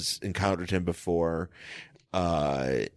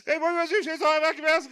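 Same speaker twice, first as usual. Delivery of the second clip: very high, strained mock panic; frantic acted quote; 3 dB louder, faster than usual. Retelling Bruce's freak-out for laughs, high mock panic. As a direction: gabble it way up high, hammed up. Acting out frantic comic exchange.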